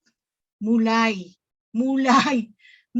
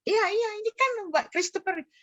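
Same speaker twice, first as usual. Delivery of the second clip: puzzled insistence; 8 semitones higher, 4 dB quieter, faster than usual. Retold or imitated. Grieving mother insists on the name.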